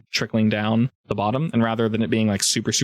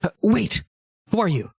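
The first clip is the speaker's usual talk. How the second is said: higher-pitched startled nereid; water nymph; 9 semitones higher than usual. Acted. Nereid stopping the intruder who is leaving, water nymph voice. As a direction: call after them as a puzzled water creature. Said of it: "Leka, she's like".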